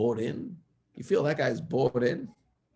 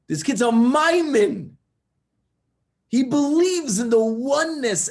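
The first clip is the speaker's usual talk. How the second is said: much higher and louder, slow and emphatic; passionate praise; 10 semitones higher, 7 dB louder than usual. Real, not acted. Rabbi telling listeners about the boy, passionate and loud.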